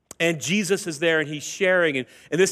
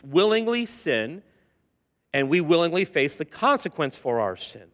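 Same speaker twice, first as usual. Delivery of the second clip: normal talking voice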